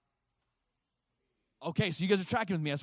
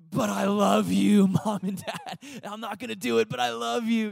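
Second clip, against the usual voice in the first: loud, high, stretched-out protest; mock child protest; 7 semitones higher, 7 dB louder than usual. Child refusing chores from Mom and Dad, hammed-up protest.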